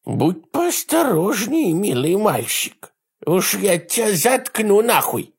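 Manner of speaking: high, slow, lisping menace; sweetly threatening with a lisp; effeminate lisp. Acted. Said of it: "женоподобный шепелявый голос".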